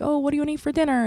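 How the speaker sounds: put-on breezy, upbeat question to a kid; cheerful mom.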